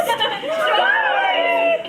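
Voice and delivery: very high and slow squeak; highest voice. Shown as a demonstration. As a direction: stretch it to the top of your range.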